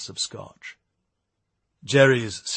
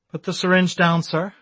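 raised, higher-pitched stern command; stern female voice; 7 semitones higher, 6 dB louder than usual. A narrator's reading. Police officer orders Eric to disarm, stern female voice. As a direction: give a firm order as a policewoman. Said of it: "came a stern female voice".

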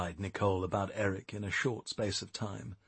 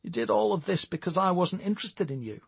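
higher-pitched, hesitant disbelief; hesitant question; 8 semitones higher than usual. A narrator's reading. Stewart asks Jerry why she did it, hesitant. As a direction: ask it tentatively, voice lifted. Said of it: "he asked hesitantly".

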